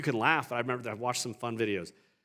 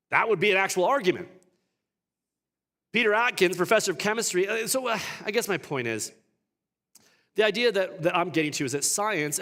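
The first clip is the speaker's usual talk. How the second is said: loud, high, driving sermon voice; preaching at full force; 6 semitones higher, 7 dB louder than usual. Acted. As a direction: preach it hard to the congregation.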